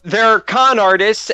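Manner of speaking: normal talking voice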